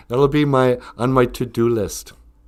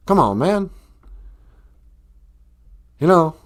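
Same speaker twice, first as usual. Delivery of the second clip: high-pitched mock Southern accent; put-on Southern twang, swooping pitch; Southern-accented politician parody; Biden impression; 6 semitones higher than usual. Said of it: "I can only do the Southern accent".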